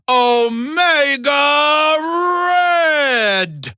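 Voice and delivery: high, loud, very slow name call; huge drawn-out introduction; ring announcer shout. Acted. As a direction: stretch the name across the arena.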